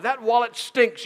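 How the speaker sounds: high, loud and slow put-down; scornful wife. Retold or imitated. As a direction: sneer at the old wallet. Wife retorts.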